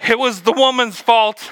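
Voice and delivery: high, whiny mock blame on "woman's"; blaming Adam. Adam answering God by blaming the woman, high and whiny.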